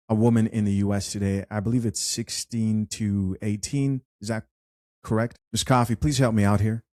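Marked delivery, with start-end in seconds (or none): none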